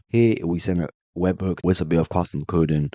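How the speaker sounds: normal talking voice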